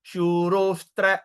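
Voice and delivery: shurostra slowly as one word, vowels drawn out; combined pronunciation. Shown as a demonstration. Teacher sums up for students, joined-word demo. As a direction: stretch shuro and join it to stra. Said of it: "when we combine the word".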